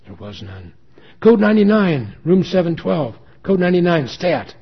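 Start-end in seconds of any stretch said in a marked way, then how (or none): 1.2-4.5 high, loud emergency shout; urgent call-out. A narrator's reading. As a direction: shout the alert for the whole ward. Nurse calls emergency code.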